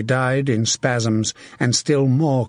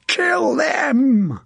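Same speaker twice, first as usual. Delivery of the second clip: very high, strained, drawn-out scream; battle scream; 14 semitones higher than usual. A narrator's reading. Sir John urging his men into the French, screaming. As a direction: scream the order to attack. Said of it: "he screamed".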